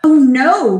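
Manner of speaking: loud, drawn-out groan of dismay; storybook crowd's moan. A narrator's reading. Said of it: "moaned the crowd".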